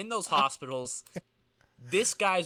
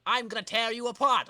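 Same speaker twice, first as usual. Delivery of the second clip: higher, louder menacing threat; villain threat; 7 semitones higher, 5 dB louder than usual. Acted. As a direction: snarl the threat at the hero.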